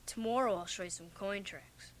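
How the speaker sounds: put-on soft and low with the little boy; older boy softly encouraging a child; gentle. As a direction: coax him kindly.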